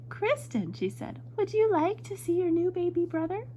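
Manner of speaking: high-pitched storybook mother; excited mother. A narrator's reading. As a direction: announce it like an eager mum. Mother announcing new baby brother.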